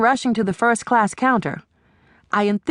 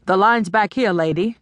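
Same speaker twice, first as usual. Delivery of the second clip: ordinary delivery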